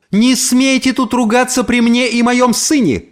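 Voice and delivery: high, outraged reprimand; indignant shout. A narrator's reading. Mother rebukes the neighbour for swearing before her son, indignant shout. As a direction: cry out in sharp protest. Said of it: "крикнула ей моя мама".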